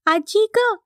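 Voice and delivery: put-on high-pitched child confiding in an elder; pleading little girl. A narrator's reading. Pundi asks grandmother for a mother, childlike plea. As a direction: complain sweetly in a high small voice.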